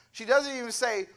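ordinary talk

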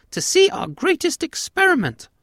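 very high, loud squeaky mouse; high-pitched cartoon mouse voice; tiny alien mouse. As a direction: squeak it out like a proud little mouse. Tiny alien mouse speaking in story.

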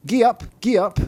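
high, loud little driver's call; crying out. A narrator's reading. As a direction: urge a horse on in a tiny, high voice. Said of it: "the little creature cried".

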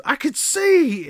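high, loud, drawn-out exclamation; mock amazement. Fake beggar claiming he can see, mock amazement. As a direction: proclaim a phony miracle, stretching the words. Retold fake miracle cure.

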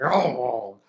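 low, rough mock bark; imitated dog bark. Preacher mimicking the neighbor's rough-sounding gang dogs, barking. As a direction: growl out a big dog's bark. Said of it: "They sound rough when they bark".

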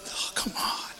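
breathless whisper of disbelief; awed whisper. Real, not acted. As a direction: whisper it in awe at the picture. Awed at the photo.

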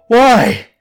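sudden high, loud cry; blurted out. Acted. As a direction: burst out at the creature. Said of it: "I blurted out".

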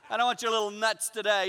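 loud, high-pitched mock outburst; frustrated. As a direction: refuse the snack in acted exasperation. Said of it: "You know, I, I'm frustrated".

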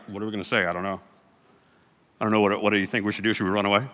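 low, soft, nervous whispering; huddled in secret, panicking quietly; mock nervous hush among friends; hushed panic.

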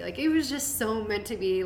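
normal talking voice

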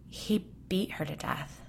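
plain reading voice

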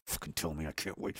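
low, muttered mock eagerness of a gloating rival; eager mock voice. Mocking the Russians and Chinese as eager rivals, low muttered glee. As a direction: sneer it out like an enemy who can hardly wait. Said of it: "They're like".

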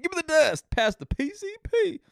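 high, loud mock outrage, rising shriller as it goes; mocking girlfriend. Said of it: "my girlfriend was like".

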